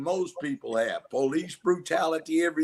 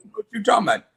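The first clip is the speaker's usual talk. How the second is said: higher, louder baffled protest; baffled civilian; 8 semitones higher, 5 dB louder, much faster than usual. Acted. Man answering an officer's demand, baffled protest. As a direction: protest as the man being questioned. Acted man questioned by police.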